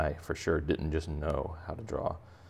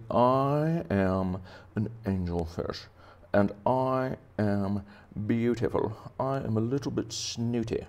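slow, drawn-out self-admiring vowels, pitched up; pouty, snooty angelfish; 6 semitones higher, slower than usual. Acted. Angelfish introducing himself as beautiful, pouty and snooty. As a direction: play a vain fish, stretching each word. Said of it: "pouty face on".

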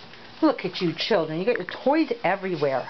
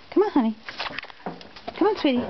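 higher, sweet coaxing voice; coaxing pet talk; 8 semitones higher, faster than usual. Real, not acted. Owner coaxing the rescue dog to come in, sweet and high.

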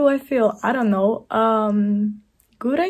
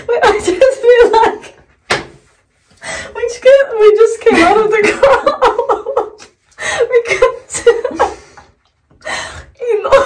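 laughing; 10 semitones higher, 14 dB louder than usual. Real, not acted. Laughing fit over the face mask.

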